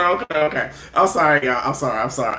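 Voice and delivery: ordinary talk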